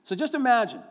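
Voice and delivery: ordinary talk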